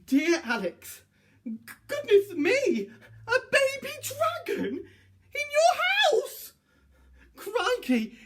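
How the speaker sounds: high, jittery voice of a frightened man; panicky letter writer. Acted. Frightened letter writer warning Alex about his dragon, high and jittery. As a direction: read it like a nervous wreck fretting over a dragon.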